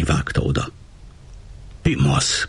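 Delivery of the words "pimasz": plain reading voice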